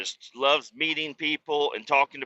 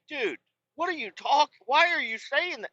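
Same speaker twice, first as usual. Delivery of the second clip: acted disbelief; 14 semitones higher than usual. Father confronting son in story.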